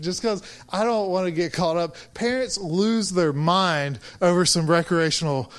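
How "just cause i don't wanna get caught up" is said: ordinary talk, nothing marked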